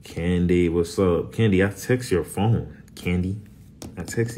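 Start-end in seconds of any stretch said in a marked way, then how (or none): none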